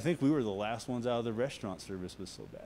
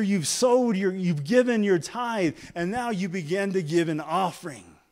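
loud, higher preaching build; full-force preaching; 6 semitones higher, 10 dB louder than usual. Acted. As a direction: drive it home to the congregation.

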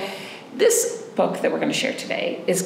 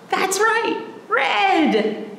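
cheerful praise; 11 semitones higher, much slower than usual. Acted. Praising children's answer.

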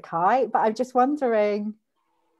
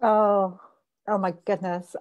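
ordinary talk

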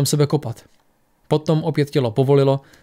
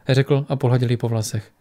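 ordinary delivery

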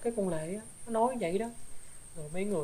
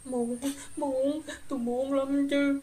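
higher, very slow drawn-out "muốn lắm chứ"; eager pleading; 5 semitones higher, much slower than usual. Acted. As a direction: stretch it out, begging to make amends. Ghost begs to atone.

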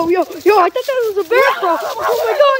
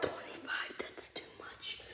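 whispering; 26 dB quieter, slower than usual. Real, not acted. Whispering while lifting weights.

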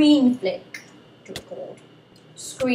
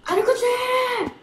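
drawn-out high shout; 11 semitones higher, slower than usual. Real, not acted. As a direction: yell it high and slow.

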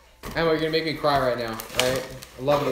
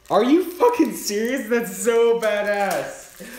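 much higher and louder, then stretched out slowly; excited shout; 8 semitones higher, 6 dB louder, slower than usual. Real, not acted. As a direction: yell with real delight at the gift. Opening a surprise gift.

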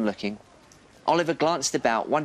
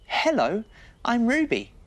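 put-on higher, friendly little girl voice; higher voice for the girl on the swings; 9 semitones higher than usual. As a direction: greet him like a small girl. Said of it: "the girl said".

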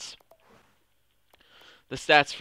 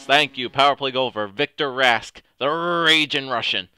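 excited shout; 3 semitones higher, 8 dB louder than usual. Real, not acted. Celebrating a power play goal.

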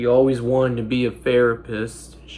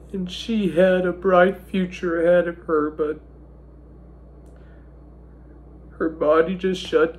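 tearful grief; 5 semitones higher than usual. Real, not acted. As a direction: voice pitched up and strained with held-back tears. Grieving brother's eulogy, voice strained.

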